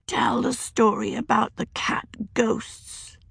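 husky, slow whisper; husky child's whisper. A narrator's reading. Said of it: "in her husky voice".

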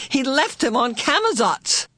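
high-pitched, distressed outcry; anguished cry. A narrator's reading. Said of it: "Meg cried".

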